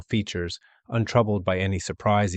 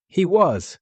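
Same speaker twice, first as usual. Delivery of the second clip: much higher, emphatic cry; defiant insistence; 10 semitones higher, 5 dB louder than usual. A narrator's reading. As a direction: insist fiercely on his father's bravery. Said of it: "he cried suddenly".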